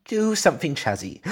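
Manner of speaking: high, loud desperate plea; pleading wife. Retold or imitated. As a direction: beg like the wife in pain. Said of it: "Sam always calls me Chazzy".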